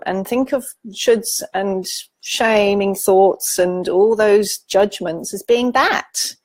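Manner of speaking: normal talking voice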